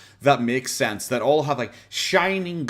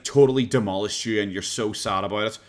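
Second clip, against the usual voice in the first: ordinary talk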